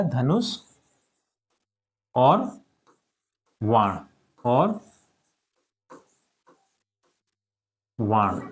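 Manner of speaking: धनुष और वाण spaced out word by word, long pauses; slow dictation. Shown as a demonstration. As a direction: dictate slowly for pupils to write. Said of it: "तो इसे हम लिख सकते हैं धनुष और वाण". (धीरे-धीरे बोलकर लिखवाना)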